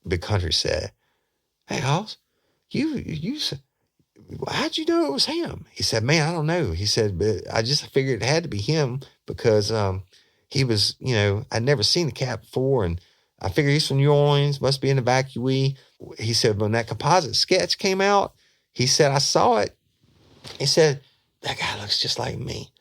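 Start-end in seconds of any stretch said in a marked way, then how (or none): 1.6-5.6 very high, folksy. Retold or imitated. Partner voiced high. 6.0-22.7 high put-on voice. Suspect voiced high throughout.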